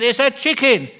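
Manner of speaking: loud, high-pitched argumentative cry; heated insistence. Acted. Wife argues with husband over the bird, heated and high. Acted quarrel, wife escalating.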